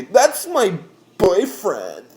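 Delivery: high, drawn-out mock girl wail; mocking whiny girls. Driver mimics girls leaning into window, whiny mockery. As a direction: stretch it out slow and high, mimicking her.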